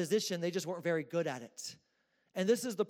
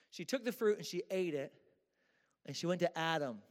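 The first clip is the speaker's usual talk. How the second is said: usual speaking voice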